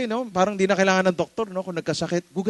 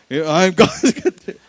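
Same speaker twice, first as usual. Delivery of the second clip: laughing misquote; 3 semitones higher, 10 dB louder, slower than usual. Shown as a demonstration. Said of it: "Not cathedral".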